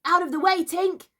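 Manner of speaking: put-on high, loud boyish shout; shouted warning. A narrator's reading. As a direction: shout to clear the way. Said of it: "he shouted".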